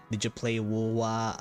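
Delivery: ordinary delivery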